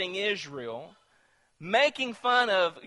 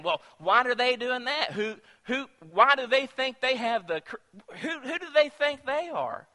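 raised-pitch imitation of a critic; mock critical carping; 6 semitones higher than usual. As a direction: carp like an offended onlooker. Imitates people criticizing believers.

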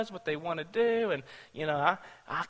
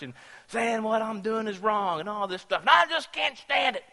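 higher, lightly voiced resentful teen; mock whiny son; 3 semitones higher than usual. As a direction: play a fed-up son complaining. Angry son complains about mother.

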